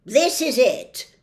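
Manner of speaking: loud, slow gruff officer; policeman. A narrator's reading. Policeman showing Milo the cell, loud and slow. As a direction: announce it like a jailer. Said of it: "said the policeman".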